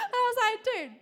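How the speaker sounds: laughing excitement at his name; excited. Real, not acted. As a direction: gush with delight. Excited telling of miracle.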